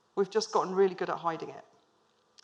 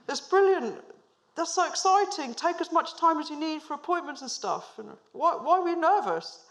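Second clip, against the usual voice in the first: put-on high, bright-eyed burst of enthusiasm, no accent; boss's delighted reply; 9 semitones higher, 4 dB louder than usual. Imitating boss Graham's thrilled reaction to her IVF news. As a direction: gush it out much higher like a thrilled boss. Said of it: "I'm not gonna do the accent".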